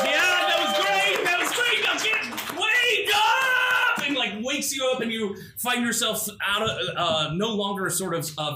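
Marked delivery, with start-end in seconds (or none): 0.0-4.0 high, loud, excited host; loud cheering host. Acted. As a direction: shout it like a game show host. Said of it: "Tony's like".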